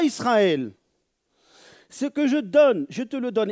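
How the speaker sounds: high, loud divine command; solemn preached decree; preaching voice. Acted. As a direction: proclaim it as God's own law. Voicing God's command.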